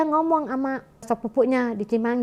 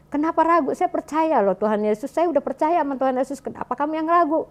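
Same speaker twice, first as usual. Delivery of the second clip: wife answering husband's doubt, raised and insistent; firm retold reply; 7 semitones higher than usual. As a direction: push back with conviction. Wife retells her firm reply.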